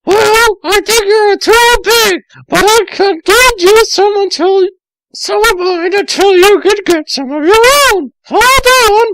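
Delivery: put-on very high, loud girly voice; high Amy. Amy offering Tails her clothes, very high girly voice. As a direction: squeal it out as a girl.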